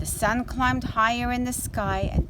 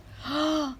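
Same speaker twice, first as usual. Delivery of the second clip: sharp gasp; 6 semitones higher than usual. Acted. Hare sees tortoise gone.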